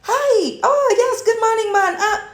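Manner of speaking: bright put-on hostess voice; fake sweet greeting.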